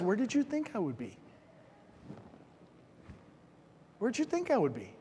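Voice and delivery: calm Jesus voice. Acted. Jesus answering his parents calmly.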